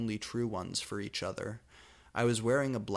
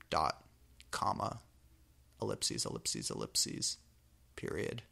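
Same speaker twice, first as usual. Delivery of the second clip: much lower, softer, doom-laden; overdramatic punctuation reading; 9 semitones lower, 5 dB quieter than usual. Acted. Reader mocking the fic's punctuation, in a deep doom voice. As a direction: intone the dots like a film trailer.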